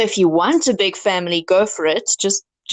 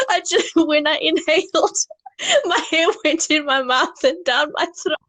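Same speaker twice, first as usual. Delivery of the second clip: talking through real laughter, high-pitched; high, giggling explanation; helpless laughter; 9 semitones higher than usual. Real, not acted. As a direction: try to explain while cracking up. Cracking up over hair mishap.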